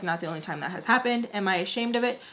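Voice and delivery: normal talking voice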